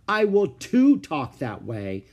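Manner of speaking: loud, slow, stubborn; defiant. Acted. Ramona defies her father, loud and stubborn. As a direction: lean on TOO in defiance.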